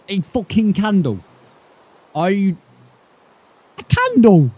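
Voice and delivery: real high-pitched disbelief; incredulous outrage. Real, not acted. Player to villager over a worthless candle trade, incredulous. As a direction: voice leaps up, aghast at the offer.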